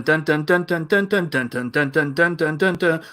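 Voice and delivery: usual speaking voice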